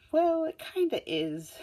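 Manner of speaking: very high, slow, put-on girl voice; Uraraka's blunt line. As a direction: squeak it out slowly in a cartoonish girl voice. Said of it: "Uraraka's like".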